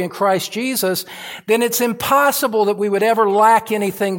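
loud, high fervent sermon climb; preaching at full force. Acted. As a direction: thunder the promise from the pulpit.

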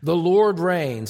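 preacher stressing the Lord. Shown as a demonstration. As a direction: lean hard into Lord. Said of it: "it's so emphatic".

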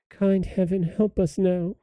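put-on high, soft plea of a little girl; girl crying out. A narrator's reading. Poor little sister praying for help, high plaintive cry. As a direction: plead in a high, softened child's voice. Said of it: "she cried".

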